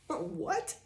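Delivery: high, sharp what of shock; stunned disbelief. Retold or imitated. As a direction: caught completely off guard. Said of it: "They're like".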